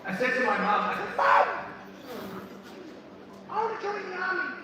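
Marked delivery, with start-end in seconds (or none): 1.2-4.5 put-on high-pitched, eager kid voice; younger self. Boy telling mum about his army dream, high younger voice.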